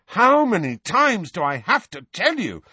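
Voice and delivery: high, loud exasperation; angry, rising. A narrator's reading. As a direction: let temper rise. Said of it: "temper and voice both rising".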